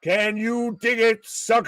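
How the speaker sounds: Booker T catchphrase. Retold or imitated. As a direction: call it out like Booker T. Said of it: "Big pop, big pop".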